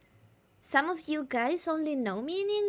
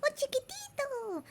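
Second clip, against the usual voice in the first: put-on much higher cooing baby talk; cooing mommy voice; 13 semitones higher, 3 dB quieter than usual. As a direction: coo softly to a tiny pet or baby. Coos at a little one.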